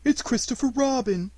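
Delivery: high, delighted announcement; eager. A narrator's reading. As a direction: pipe up eagerly on spotting a friend. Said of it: "said Pooh eagerly".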